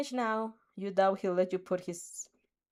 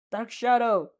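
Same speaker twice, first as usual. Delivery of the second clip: louder call to his quirk; 2 semitones higher, 7 dB louder, slower than usual. A narrator's reading. Tokoyami calls his quirk to the rescue, louder. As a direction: summon his shadow with a sharp call. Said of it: "he calls".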